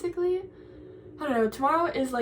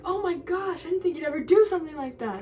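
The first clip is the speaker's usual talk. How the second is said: imitating a shocked friend; 5 semitones higher than usual. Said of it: "she's like".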